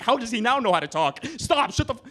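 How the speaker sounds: hissing panic that climbs to a yelp; panicked PR staffer. Retold or imitated. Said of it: "the P R people are like".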